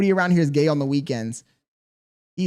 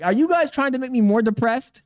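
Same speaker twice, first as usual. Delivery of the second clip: higher, louder indignant whine; mock outraged protest; 8 semitones higher, 5 dB louder than usual. Streamer mock-protesting to chat about teasing, higher and louder. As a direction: complain loudly to chat, half joking.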